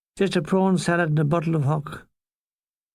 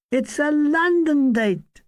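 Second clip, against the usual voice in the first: higher pitched, pressing the point of London date; stressed insistence; 9 semitones higher than usual. Retold or imitated. Comic insisting to his partner about the booking, emphatic and raised. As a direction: push it hard: a London date. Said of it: "I stressed".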